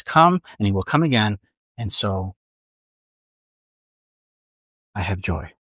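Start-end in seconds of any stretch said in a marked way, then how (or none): 1.8-5.5 quiet. Acted. Quiet sermon climax.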